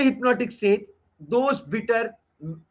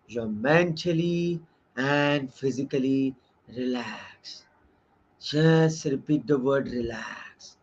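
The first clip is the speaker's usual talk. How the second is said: low, slow, soothing hypnotist drone; 7 semitones lower, much slower than usual. Shown as a demonstration. Teacher demonstrating how hypnotists talk to subjects, slow low hypnotic voice. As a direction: lull them down like a hypnotist. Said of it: "they talk to you like this".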